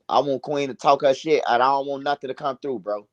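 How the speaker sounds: usual speaking voice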